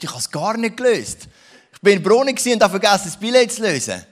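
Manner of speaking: blurting in alarm at the forgotten ticket; panicked rush. Retold or imitated. (hastiger, lauterer Panikausbruch)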